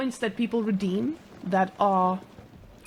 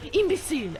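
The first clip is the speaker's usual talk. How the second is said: a higher, scornful Imbecile in a fake French accent; mock French accent; 7 semitones higher than usual. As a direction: sneer Imbecile like a cartoon Frenchman. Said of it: "I can't do French accents".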